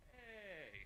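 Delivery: ordinary talk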